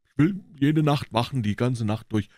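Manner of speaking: eager Huck. Acted. Huck agreeing to keep watch, eager boyish voice.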